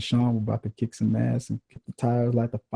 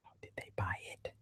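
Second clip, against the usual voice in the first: whisper; 21 dB quieter than usual. Real, not acted. Whispered aside.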